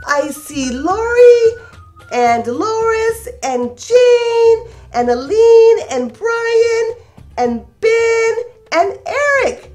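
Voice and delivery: usual speaking voice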